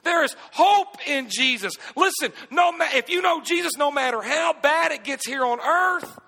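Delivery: high, loud, rising preacher's cry; preaching at full force. Acted. Pastor preaching to his congregation, full force. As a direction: build it up and preach it hard.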